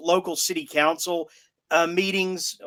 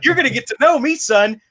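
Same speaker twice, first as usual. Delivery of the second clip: puffed-up swagger voice, louder; mocking tough guy; 7 semitones higher, 7 dB louder than usual. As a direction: strut it, mock bravado. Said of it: "he struts like he's actually done something".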